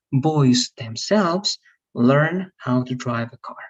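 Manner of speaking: "themselves" stressed, slow model sentence; slow, lean on "themselves"; emphasis on the noun. Shown as a demonstration. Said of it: "the emphasis is on the noun".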